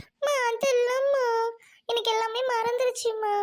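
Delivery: mock-tearful daughter's complaint; tearful whine. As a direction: play a weepy child, stretching the words slowly. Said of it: "அக்கா அழுதுட்டு இருக்க மாதிரி".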